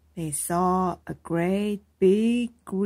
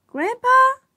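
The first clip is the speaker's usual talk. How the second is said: high, loud call to grandpa; loud, high shout upward; 9 dB louder than usual. A narrator's reading. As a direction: call down from the treetop. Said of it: "called Iris".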